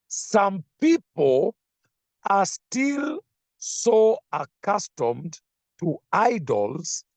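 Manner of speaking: slow, lifted reading of the verse; scripture reading. Acted. Preacher reading a verse to the congregation, slow and lifted. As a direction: read the verse slowly and deliberately.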